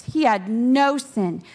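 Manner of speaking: ordinary talk, nothing marked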